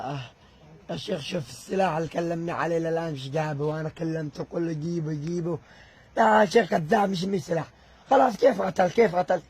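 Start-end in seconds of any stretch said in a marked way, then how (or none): 6.2-9.4 loud furious accusation; angry shout. Real, not acted. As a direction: shout him down, calling him a liar. Accusing the sheikh's opponent of lying. (صراخ غاضب)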